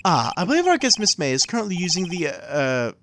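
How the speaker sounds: put-on polite old-fashioned bellhop; lightly voiced formal concierge; concierge bellboy voice. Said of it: "what's a good bellboy voice".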